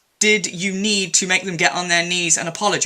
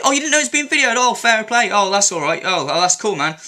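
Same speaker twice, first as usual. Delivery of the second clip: sarcastic mock approval; 4 semitones higher than usual. Real, not acted. Sarcastic reply to her excuse.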